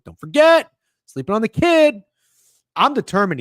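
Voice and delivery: a fired-up streamer boasting to chat; hyped boast. Real, not acted. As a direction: shout it like a cocky reminder. Hyping his own comeback.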